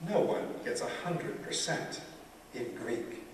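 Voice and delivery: hushed imitation of a teacher's reply; quiet quoted verdict. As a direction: quote the teacher softly, pausing before the last words. Retold teacher's verdict, acted.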